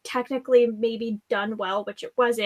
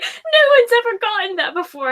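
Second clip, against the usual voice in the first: higher, louder, hammed-up pleased voice; mock smug boy; 10 semitones higher, 6 dB louder than usual. Friend mocking Artemis bragging about his pseudonym, hammed-up smug. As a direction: play him as proud of himself, overdone. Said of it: "And he's like".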